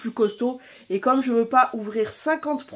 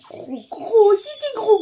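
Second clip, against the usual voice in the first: pet voice; 11 semitones higher, 8 dB louder than usual. Pretend.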